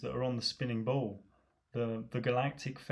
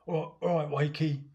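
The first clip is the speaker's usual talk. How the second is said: higher, louder matey hello; loud pub greeting; 4 semitones higher, 5 dB louder than usual. Retold or imitated. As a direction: hail a friend across the pub. Pub mate greeting loudly.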